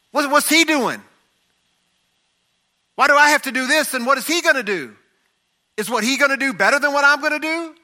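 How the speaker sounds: high-pitched, worked-up complaint; indignant questioning. Retold or imitated. Acting out Peter's jealous questioning.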